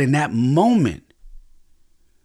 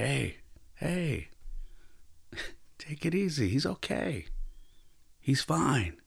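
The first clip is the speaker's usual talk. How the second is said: soft, amused and reassuring, much softer; gentle half smile; 4 semitones higher, 11 dB quieter than usual. Retold or imitated. Grandfather calming his tense son about the toddler, softly amused. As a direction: ease the worried parent down with a smile. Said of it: "with a half smile".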